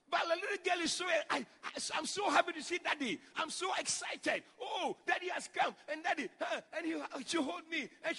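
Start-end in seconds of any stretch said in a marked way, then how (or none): 1.9-5.8 put-on higher, slightly louder child voice; excited child. Preacher voices his daughter overjoyed to see him, high and excited. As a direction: gush with joy at daddy's arrival.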